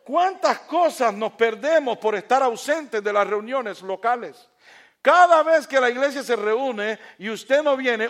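loud, high, emphatic sermon; fiery preaching. Acted. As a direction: preach it at full force to the congregation. Preacher rises to full force.

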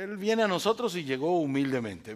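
normal talking voice

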